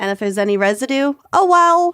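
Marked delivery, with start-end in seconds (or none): 1.3-1.9 loud, breezy oh well; careless shrug. Acted. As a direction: toss it off, loud. Shrugging off the residue.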